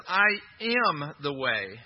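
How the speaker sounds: "I am the way" slow, every word weighted; slow emphatic quote. Shown as a demonstration. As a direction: pace it out like a declaration. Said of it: "He says".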